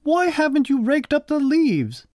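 high-pitched scolding mother; storybook mother's shout, not full volume. A narrator's reading. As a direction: demand an answer in a high voice. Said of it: "she shouted".

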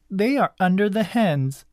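put-on high-pitched bossy girl; high voice of a child in charge; bossy cousin. A narrator's reading. Bossy cousin giving orders.

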